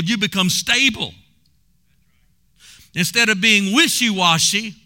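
high, driving revival preacher; punchy Southern pulpit delivery; fervent preaching. Real, not acted. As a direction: drive the point home to the congregation. Preaches the moral at full force.

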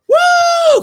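high, loud whoop of joy; ecstatic woo. Real, not acted. Preacher whooping in praise to the church, loud woo. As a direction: whoop it out, full voice. Ecstatic cry mid sermon.

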